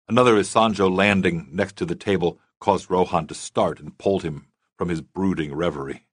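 usual speaking voice

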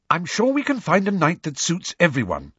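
high, brisk-edged reassurance with impatience; slightly impatient. A narrator's reading. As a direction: let slight impatience show. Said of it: "said Hermione slightly impatiently".

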